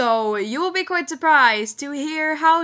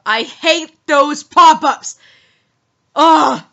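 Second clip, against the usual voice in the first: annoyed; 3 semitones higher, 9 dB louder, slower than usual. Real, not acted. Annoyed by pop-up.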